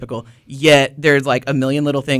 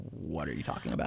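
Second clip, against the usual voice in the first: much lower and softer, mumbled bafflement; confused locals; 9 semitones lower, 16 dB quieter than usual. Retold or imitated. Puzzled locals hearing odd Texan slang, muttered. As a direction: toss it off low like puzzled locals. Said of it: "people are like".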